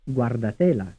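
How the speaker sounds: "Guardatela" with the beat on TE; third-syllable stress. Shown as a demonstration. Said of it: "stressed on the third syllable".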